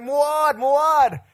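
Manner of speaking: very high, loud imitated scream. Friend in the road screaming for the driver, imitated. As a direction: holler the name after a passing car. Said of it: "But she screams".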